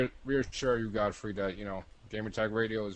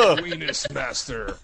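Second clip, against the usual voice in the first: slow, laughing movie-title voice; horror trailer voice; 7 semitones higher, 10 dB louder, much slower than usual. Acted. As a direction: savor each word like a title. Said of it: "that's like a title for the ultimate B movie".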